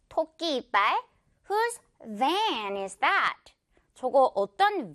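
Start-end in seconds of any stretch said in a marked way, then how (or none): 1.5-3.5 very slow sentence, "van" stretched with buzzing v; clear V demo. Shown as a demonstration. Teacher modeling the example question, slow clear V. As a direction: model it slowly, leaning on the v in "van". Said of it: "V 발음".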